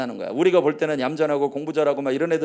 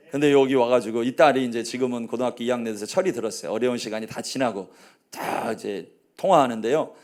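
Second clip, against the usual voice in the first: ordinary delivery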